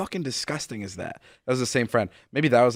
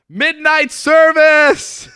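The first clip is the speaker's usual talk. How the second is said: drawn-out high shout; scream; 11 dB louder, much slower than usual. Retold or imitated. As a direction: yell it slowly through the cabin. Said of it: "he'd scream".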